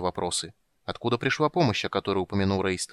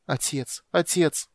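begging cry; 6 semitones higher, much slower than usual. Acted. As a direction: implore him to hear you. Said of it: "молила Эльхана".

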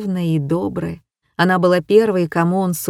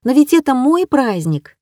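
put-on excited young girl; 6 semitones higher than usual. A narrator's reading. Fawn insisting.

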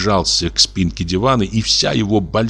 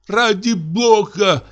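much higher, slow, wailing cry; strange-voiced cry; 13 semitones higher, much slower than usual. Wife in hysterics crying out, much higher, slow wail. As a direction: cry out in a voice not her own. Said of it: "кричала она не своим голосом".

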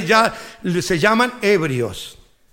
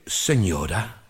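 much lower, soft deadpan waiter; a low, servile "señora"; 14 semitones lower, 5 dB quieter, much slower than usual. Retold or imitated. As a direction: waiter answering the old lady. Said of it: "Viene el mozo, le dice".

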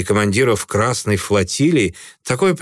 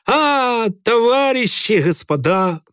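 high, drawn-out mocking greeting; drawling sneer; 11 semitones higher, slower than usual. A narrator's reading. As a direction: stretch the words out, mocking. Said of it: "протянул он". (протяжно, с издёвкой)

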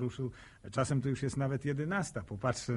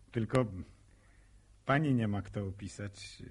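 ordinary talk, nothing marked

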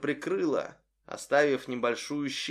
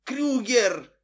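panicked cry; 8 semitones higher than usual. Acted.

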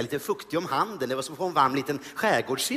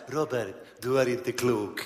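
lower, slow, soft murmur; Queen Silvia whisper; 6 semitones lower, 3 dB quieter, slower than usual. Retold or imitated. Queen Silvia teasing the comedian in his ear, soft and slow. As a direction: lean in and tease gently. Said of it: "viskar in i mitt öra".

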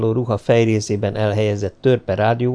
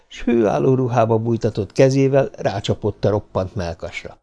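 ordinary delivery, nothing marked

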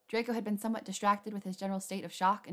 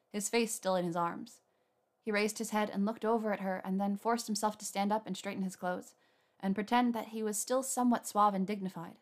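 ordinary delivery, nothing marked

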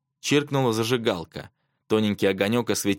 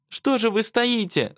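high, slow outcry; exasperated high-pitched cry; wailing yell; 13 semitones higher, slower than usual. A narrator's reading. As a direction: wail at them to act. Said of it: "завопила Павлова".

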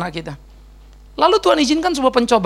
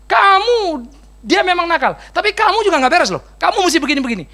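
scolding God; 7 semitones higher than usual. Acted. Tuhan menegur Ayub.

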